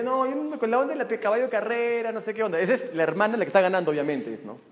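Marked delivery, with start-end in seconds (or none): 0.0-2.6 higher, doubtful girlfriend imitation; girlfriend's voice. Girlfriend doubting the racehorse idea, a light high imitation. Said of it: "mi novia me decía".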